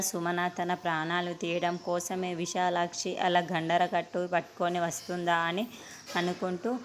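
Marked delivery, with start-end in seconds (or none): none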